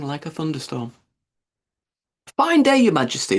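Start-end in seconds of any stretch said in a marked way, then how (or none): none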